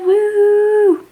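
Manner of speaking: high, loud woo; cheer. Real, not acted. Signing off to viewers with a loud whoop. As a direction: whoop with joy.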